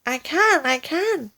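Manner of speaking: high eager cry; cried. Acted. Wife answers husband while being stretched, high cry. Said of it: "cried Missus Twit".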